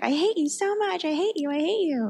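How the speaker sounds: high-pitched mock wail; imitated crying. New believer crying at God in worship, imitated wailing. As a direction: wail it repeatedly, far above normal pitch. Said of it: "I was just crying and crying".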